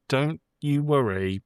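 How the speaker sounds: normal talking voice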